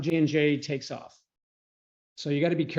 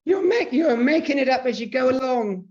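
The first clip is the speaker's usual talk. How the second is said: high, loud mock heckle; Monty Python heckler; 10 semitones higher, 6 dB louder than usual. Presenter quoting a comedy film to the group, high loud heckle. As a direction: call it out like a comic film heckler. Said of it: "that's where I got that".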